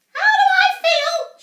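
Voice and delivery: high, loud furious yell. A narrator's reading. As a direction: yell it back in fury. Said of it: "she yelled".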